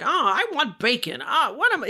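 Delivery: hammed-up bad American accent, pitched high and swooping; mock a terrible American accent; Ewan McGregor. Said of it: "He just kinda talks like this".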